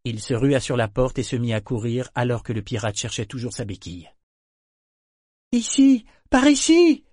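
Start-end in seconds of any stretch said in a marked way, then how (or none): 5.5-7.0 put-on high, loud shout to the boy; urgent shouted summons; calling out. A narrator's reading. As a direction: call him over, much higher and louder. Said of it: "criait Vivacia à Yémin".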